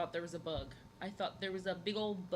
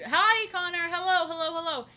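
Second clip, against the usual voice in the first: excited shout of hello to a viewer; loud greeting; 10 semitones higher, 11 dB louder than usual. Real, not acted. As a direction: call out high and loud. Greets a viewer named Connor.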